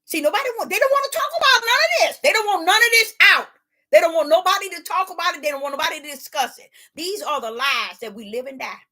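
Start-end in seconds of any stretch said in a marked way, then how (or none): 0.1-6.7 louder and higher, quicker preaching; rising preaching. Real, not acted. Preacher pressing the congregation, louder and faster. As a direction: build the fervor on the congregation.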